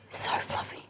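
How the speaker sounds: breathy whispered aside; whispering. Real, not acted. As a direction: whisper it to yourself. Whispered aside about cake.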